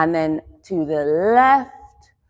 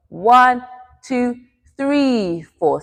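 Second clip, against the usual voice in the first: rhythmic dance count; 4 semitones higher, much slower than usual. Shown as a demonstration. Teacher leads the other side, counting in time. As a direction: count slowly on the beat. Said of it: "We go".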